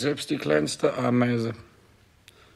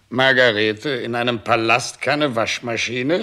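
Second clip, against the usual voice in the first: much louder, calling out Margarete in outrage; indignant outcry; 6 dB louder than usual. Acted. King shouts at Margarete about the palace laundry, indignant. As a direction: let the indignation burst out.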